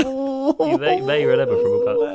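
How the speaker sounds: very high, loud squeaky mockery of his own line; self-mocking the serious podcast opener; mock falsetto. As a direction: squeak it out, sending up your own intro. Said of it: "What, what a joke".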